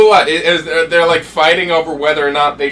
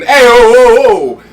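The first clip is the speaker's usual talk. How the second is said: Brooklyn Italian "Ayo, oh" called out louder; mock southern Italian; 7 semitones higher, 8 dB louder, much slower than usual. Shown as a demonstration. Host demoing southern Italians' greeting in a mock Brooklyn Italian accent. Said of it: "there's two types of Italians".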